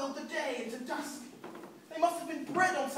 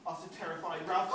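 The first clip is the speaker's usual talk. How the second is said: plain reading voice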